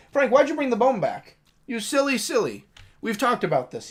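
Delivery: high-pitched fond telling-off; playful pet scolding. Real, not acted. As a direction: scold your dog in a silly high voice. Playfully scolding the dog Frank.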